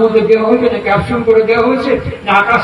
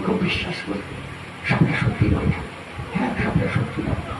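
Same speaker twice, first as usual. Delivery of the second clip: whispered admission; 3 semitones lower, 11 dB quieter than usual. Acted.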